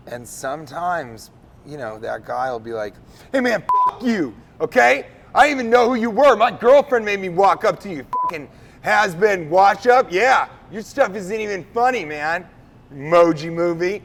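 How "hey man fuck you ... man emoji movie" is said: high, loud furious yelling; angry rant. Retold or imitated. Angry stranger yelling insults after a refused photo. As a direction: scream abuse at him like a bitter fan. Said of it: "That's a little aggressive".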